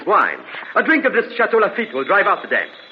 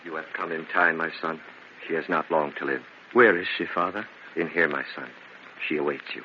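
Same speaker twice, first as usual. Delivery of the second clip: low, soft priestly murmur; hushed priest; 13 semitones lower, 9 dB quieter than usual. Acted. Priest hushes Angelo at Lucia's deathbed, low and soft. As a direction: speak gently at a deathbed.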